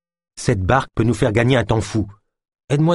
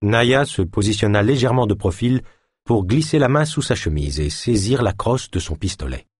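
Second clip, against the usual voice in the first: ordinary delivery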